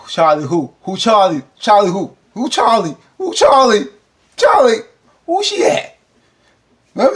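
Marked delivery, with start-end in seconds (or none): 0.1-6.0 climbing higher and louder with each Charlie who; rising jealous outburst. Acted. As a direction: build from a loud question to a shrill shout. Acting Nova's jealous reaction.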